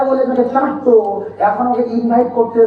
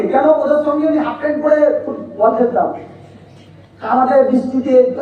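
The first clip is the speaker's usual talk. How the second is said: usual speaking voice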